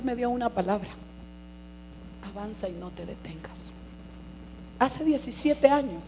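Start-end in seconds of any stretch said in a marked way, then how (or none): 2.3-3.7 susurrado y sin voz; susurro. Retold or imitated. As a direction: susurra la palabra como un secreto. Cita la palabra del Señor. (susurro de la palabra recibida)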